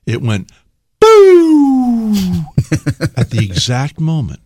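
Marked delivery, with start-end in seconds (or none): 1.0-2.1 a high, loud electronic boo; mock GPS boo. Imitating the GPS cutting out, a high loud boo. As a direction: pop out a sudden high boo like a dying gadget.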